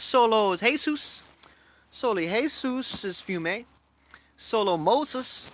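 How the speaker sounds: slow, high-pitched attempt at Spanish; halting Spanish. Real, not acted. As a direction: sound it out slowly and high, searching for the words. Piecing together halting Spanish.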